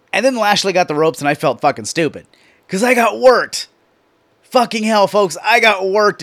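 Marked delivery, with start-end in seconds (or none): 2.7-6.2 excited. Real, not acted. Thrilled at being fooled.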